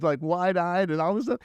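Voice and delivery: normal talking voice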